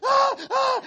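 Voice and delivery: high, loud hammed-up yelps; overdone startled cries; mock frightened yelps. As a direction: squeal like a scared city boy. Imitating himself yelping.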